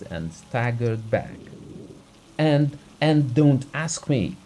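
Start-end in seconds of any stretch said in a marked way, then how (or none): 2.4-4.4 louder, higher, stammered refusal; alarmed. A narrator's reading. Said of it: "in a tone of alarm".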